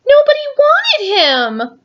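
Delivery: put-on high kid voice; child character voice. Parent voicing young Billy while reading aloud. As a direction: pipe up like a sad little boy. Character quote in read story.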